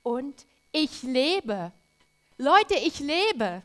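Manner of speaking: slow, loud and jubilant; drawn-out joyful declaration; with great enthusiasm. Retold or imitated. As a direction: proclaim it with full enthusiasm. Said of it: "das sagte er mit ganzem Enthusiasmus".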